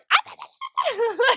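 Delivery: high, loud mock shriek; acted-out excited shriek. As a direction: re-enact waking up shrieking. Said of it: "I woke up that morning going".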